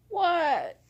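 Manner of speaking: high, drawn-out falling question; a long, high "what" from a bothered pet; voiced reluctant dog. Sleepy dog looking up at owner who calls, high drawn-out reply. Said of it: "he would look at me like".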